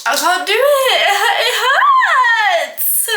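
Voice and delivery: high, loud wail breaking into laughter; exhausted whine. Real, not acted. Baker giving up on mixing by hand, exhausted whine. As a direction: whine it out loudly, half laughing.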